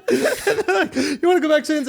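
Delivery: laughing through a high retold question. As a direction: crack up while quoting the parents. Retelling parents, laughing through it.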